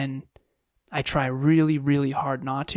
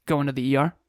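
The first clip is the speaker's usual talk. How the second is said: ordinary delivery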